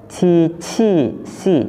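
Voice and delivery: "Ci, ci, si" with sibilant hiss on each onset; slow, spaced "Ci, ci, si" with hissing starts; hissed consonants. Shown as a demonstration. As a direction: hiss through "Ci, ci, si", second "ci" puffed.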